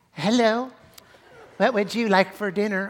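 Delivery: high, loud put-on waiter voice; mock unmanly waiter. Preacher mimics young restaurant waiter, mock unmanly voice. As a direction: imitate a waiter in a hammed-up unmanly pitch. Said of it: "why you're talking like that".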